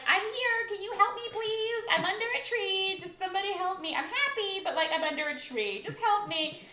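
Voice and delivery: high, pleading little robot; pleading droid voice. Acted. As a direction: beg for help like a stuck droid. Voicing stuck BB-8 droid.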